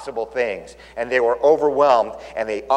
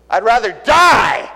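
louder, slow, stubborn declaration; defiant Jonah; 7 semitones higher, 7 dB louder, slower than usual. Retold or imitated. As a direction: say it like a man who'd choose death. Jonah's defiant imagined words.

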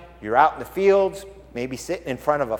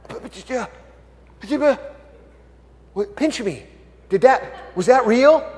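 higher, halting, stammered disbelief; stunned shepherds; 6 semitones higher than usual. Acted. Shepherds to each other once the angels are gone, stunned stammering. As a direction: stammer as if you can't believe it. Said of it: "And they look at each other".

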